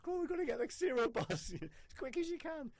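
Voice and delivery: high, loud, laughing panic; flustered stewardess. Retold or imitated. Stewardess phoning in a panic about cereal bars, high and laughing. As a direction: play the frantic stewardess with a breathless laugh. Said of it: "And she phones me up and says".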